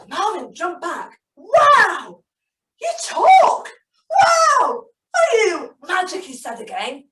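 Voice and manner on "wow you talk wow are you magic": high, loud wonder; amazed. Acted. Marvin astonished the fish can talk, high and loud. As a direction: gasp it out astonished.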